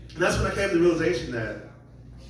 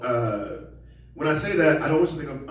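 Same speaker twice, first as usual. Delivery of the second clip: ordinary delivery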